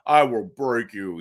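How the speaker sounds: lightly voiced Ivan Drago threat. Host imitating Drago to describe the defenceman, lightly menacing. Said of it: "he was like Drago from Rocky".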